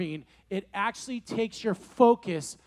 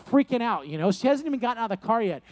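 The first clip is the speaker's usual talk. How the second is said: normal talking voice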